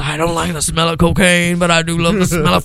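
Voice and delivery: playful dog voice, lightly put on; imagined police dog loving the smell, mock voice. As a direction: relish it like a happy sniffer dog. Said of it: "They must have been like".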